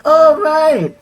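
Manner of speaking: high, loud exclamation of recognition; acted realisation. Driver learns she works with the TV crew, high loud realisation. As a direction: imitate his sudden realisation, high and loud. Man's acted reaction to news.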